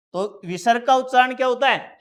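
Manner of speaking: ordinary talk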